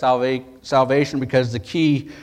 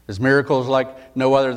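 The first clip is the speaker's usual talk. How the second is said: ordinary talk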